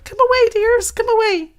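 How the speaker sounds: high, calling canary voice. Acted. Canary calling its children home in a high voice. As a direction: call the little ones in, anxious. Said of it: "called out in a trembling voice".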